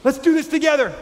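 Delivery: hammed-up enthusiastic invitation; eager God. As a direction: call them in like a team captain. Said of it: "You know".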